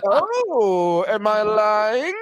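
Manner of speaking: high, slow feigned innocence; mock innocent. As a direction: play dumb, drawn out and high. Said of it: "he's like".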